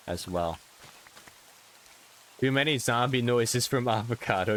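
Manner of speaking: ordinary talk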